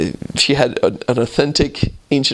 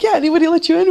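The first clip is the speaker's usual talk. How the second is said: high curious woman; faster than usual. Retold or imitated. Woman quizzing missionaries.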